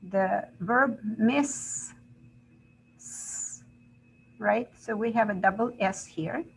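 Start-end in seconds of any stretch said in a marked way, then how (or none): 3.0-3.6 long voiceless "s" hiss; soft, sustained "s" for demonstration; isolated S sound. Shown as a demonstration. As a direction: hold the "s" alone as a hissing consonant. Demonstrates the final hissing sound.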